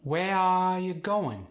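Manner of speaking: high, slow Khmer accent, stretched vowels; Khmer-accented, long singsong vowels. Shown as a demonstration. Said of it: "Vowels are elongated quite often".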